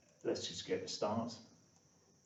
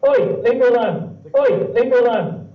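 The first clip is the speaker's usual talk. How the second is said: high, loud squeaky cat yelling; an annoyed cartoon cat shrieking; 13 semitones higher, 21 dB louder than usual. Shown as a demonstration. Recorded cat sound plays back.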